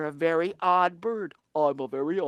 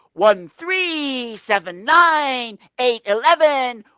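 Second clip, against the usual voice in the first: much higher, loud goofy counting; wild shout; 9 semitones higher, 13 dB louder, slower than usual. Acted. Tacky marches badly and tumbles, shouting. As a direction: yell it all wrong and fall. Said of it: "He goes".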